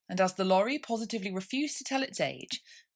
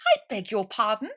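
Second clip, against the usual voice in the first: polite frowning mouse; 3 semitones higher, 7 dB louder than usual. A narrator's reading. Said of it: "frowning, but very politely".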